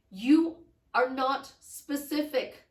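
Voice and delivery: slow, loud and emphatic; firm emphasis. Real, not acted. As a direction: spell it out firmly, word by word. Coach scolding listener's vague wish.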